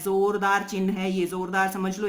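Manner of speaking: normal talking voice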